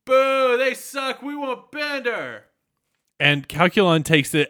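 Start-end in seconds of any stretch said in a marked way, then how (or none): 0.1-2.4 high mocking heckle, fading slower at the end; Bender heckling. Bender heckling the auditions from outside, high mocking jeer. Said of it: "Bender's right outside going".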